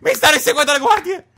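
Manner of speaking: being chased. Real, not acted. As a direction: shout it while running away. Chased by guards in game.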